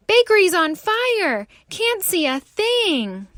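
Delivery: high, loud urgent alarm; panicked caller. Acted. Caller alerting the fireman about the fire. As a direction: shout it in a panic.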